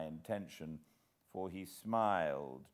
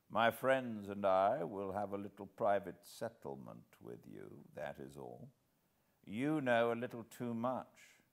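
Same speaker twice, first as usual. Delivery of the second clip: put-on sly old master. Old host to trapped Hannay, silky old-villain purr. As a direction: purr it like a polite old villain savouring his catch.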